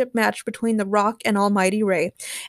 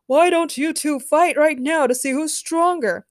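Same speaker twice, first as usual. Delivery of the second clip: high, raised crowd shout; yelled heckle; 7 semitones higher, 4 dB louder than usual. A narrator's reading. Another spectator urges a fight now, yelling. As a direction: yell a goading question. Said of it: "yelled another person".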